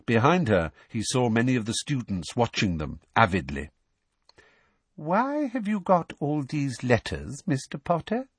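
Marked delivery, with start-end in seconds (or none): 4.9-8.3 put-on high, slow, falsely sweet girlish voice; slow, sugary interrogation; slow questioning. A narrator's reading. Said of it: "she asked slowly".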